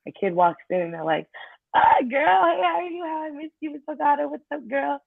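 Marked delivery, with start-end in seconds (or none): 1.7-5.0 squealing high delighted hello; excited kid greeting. Retold or imitated. Kid running in to greet favorite teacher, high and excited. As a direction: greet her like you missed her so much.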